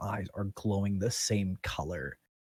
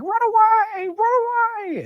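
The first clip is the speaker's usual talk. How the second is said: much higher and louder, panicked tiny-creature squeal; tiny fleeing critters; 11 dB louder, slower than usual. Acted. Small forest creatures fleeing the magic, high panicked squeal. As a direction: shriek it like a mouse running for its life. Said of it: "smaller creatures and birds just being like".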